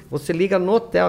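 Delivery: usual speaking voice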